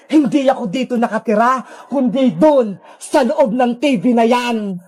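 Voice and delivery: low-pitched captor voice, drawing out the last words; Sadako villain voice. Acted. Sadako leading Princess to her house, low and menacing. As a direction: coax the captive along in a low villain voice. Sadako reveals she lives inside TV.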